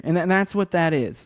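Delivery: usual speaking voice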